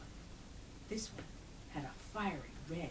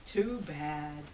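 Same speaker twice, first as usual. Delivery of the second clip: mild sigh; 6 semitones higher, 4 dB louder, much slower than usual. A narrator's reading. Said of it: "Herschel sighed".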